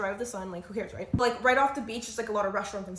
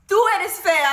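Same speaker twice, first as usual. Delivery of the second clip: much higher, loud shouted insult; Lewis yelling; 10 semitones higher, 13 dB louder than usual. Retold or imitated. Boyfriend shouting back at the rude girl, loud and high. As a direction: yell it back at her. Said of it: "Lewis yells".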